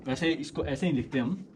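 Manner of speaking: normal talking voice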